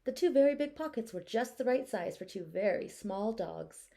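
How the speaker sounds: plain reading voice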